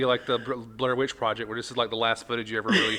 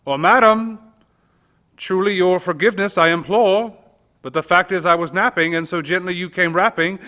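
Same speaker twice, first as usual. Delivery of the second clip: higher, louder, urgent apology to the visitor; raised pleading voice; 7 semitones higher, 9 dB louder than usual. Acted. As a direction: call out to the door, begging pardon. Narrator voices apology in poem.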